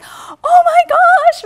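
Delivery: put-on kids' delighted gasp. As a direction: squeal like a child seeing a puppy. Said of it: "it's like".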